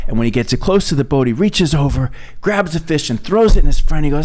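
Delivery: ordinary talk, nothing marked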